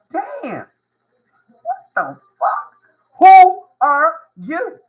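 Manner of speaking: high, stunned disbelief building to a loud drawn-out question; mock being floored, each word slow and punched; imitated astonishment. Onlookers reacting to you, imitated loud, high, slow amazement. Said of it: "looking at you and saying".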